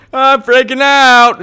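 high, loud, drawn-out wail; stretched high cry of gamer panic; freaking out. Real, not acted. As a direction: wail it slowly in mounting panic.

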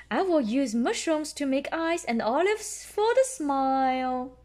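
a hint of a proud father; Daddy Pig voice. A narrator's reading. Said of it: "that's what Daddy Pig says".

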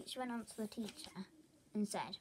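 ordinary talk

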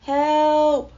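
high, loud held holler; hollered plea. A narrator's reading. Bear tumbling down, hollering for help. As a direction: holler for rescue, stretching the word. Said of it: "hollered the bear".

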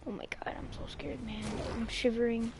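mock scared whisper. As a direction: breathe it out like you're spooked in the dark. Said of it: "I'm scared".

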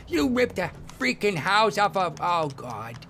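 a squealing pal overreacting; Lester voice. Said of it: "But I'll just reenact it".

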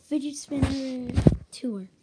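slow, loud title call; loud announcement. Acted. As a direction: boom out the video title.

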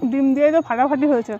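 speaking between bites; talking while chewing. Real, not acted. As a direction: mouth partly full of food. Seen 0: Ate just before; cheeks and mouth look full while speaking.

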